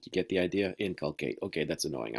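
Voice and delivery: ordinary talk, nothing marked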